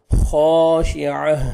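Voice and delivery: खाशिअह slow, ending on a breathy ह; Quranic recitation. Shown as a demonstration. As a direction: stop on ह in खाशिअह. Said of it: "last में हा का sound आना चाहिए". (खाशिअह, आख़िर में ह की आवाज़)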